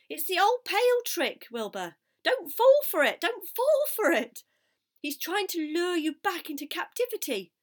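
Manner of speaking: loud, high, urgent goose cries; alarmed, shrill-pitched pleading yell; frantic warning. A narrator's reading. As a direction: shout to stop someone walking into a trap. Goose frantically warns the pig.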